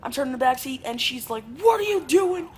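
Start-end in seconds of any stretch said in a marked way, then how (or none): 1.6-2.5 high, loud, indignant demand; imitated scolding mom. Mom turning to back seat, high angry mock shout. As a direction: snap it as an outraged parent, pitched way up.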